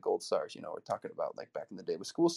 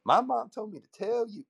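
Kevin Hart bit; 9 semitones higher, slower than usual. Retold or imitated. Kevin Hart joke retold as a high comic kid. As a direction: squeak it out like a tattling kid. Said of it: "No, I'm just kidding".

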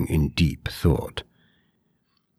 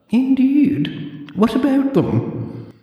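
put-on high, prim old librarian; clipped, fussy old lady librarian; 4 dB louder, much slower than usual. A narrator's reading. Deirdre voiced.

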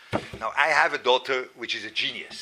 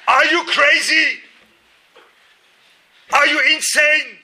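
put-on loud high furious yelling at a child; imitated scream; 12 semitones higher, 10 dB louder than usual. Father screaming at daughter over spilled soup. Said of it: "That's what I would scream".

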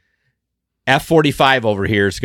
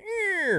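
mock swing noise; 10 dB quieter than usual. Dock swung in beside shore.

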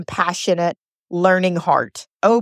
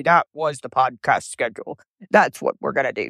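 lower, offhand voice of routine; mock dutiful tone; 6 semitones lower than usual. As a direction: wave it off as mere duty. Mocking a dutiful podcast mindset.

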